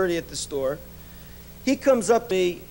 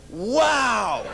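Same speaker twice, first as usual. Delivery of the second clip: amazed wow. Retold or imitated. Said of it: "You know what he said?".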